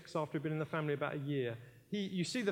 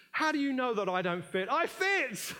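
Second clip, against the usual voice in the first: defiant shout; 7 semitones higher, 4 dB louder than usual. Acted. Child defiantly claims belonging.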